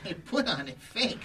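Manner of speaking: high, giggly impression with creepy laughs; Peter Lorre. Shown as a demonstration. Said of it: "yeah, Peter Lorre".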